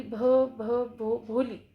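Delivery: imitating a stammer until the name comes out; halting, repeating the first sound before भोली. Bholi finally says her full name to the teacher, stammering. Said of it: "बड़ी मुश्किल से बोली वो".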